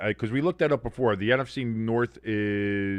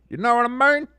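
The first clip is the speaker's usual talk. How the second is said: much higher, louder comic echo; silly put-on voice; 13 semitones higher, 7 dB louder than usual. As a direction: repeat it in an exaggerated high-pitched silly voice. Repeats it in a silly voice.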